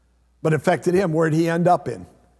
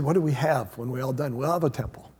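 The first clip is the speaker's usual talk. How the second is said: quiet musing; 5 semitones lower, 6 dB quieter, faster than usual. Retold or imitated. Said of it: "And he said".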